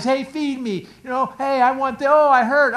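put-on a baby begging to be fed; whining baby soul. Soul whining like a hungry baby. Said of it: "And it whines and cries".

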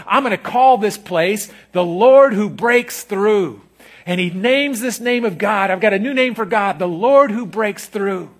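slow, loud, drawn-out proclamation; preacher announcing God's name; slow proclaimed naming. Acted. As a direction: declare the new name.

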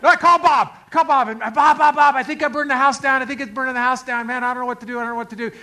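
loud, high, frantic repeating; acted-out panic to a buddy; panicked phone call. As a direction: burst out in alarm, easing slightly toward the end.

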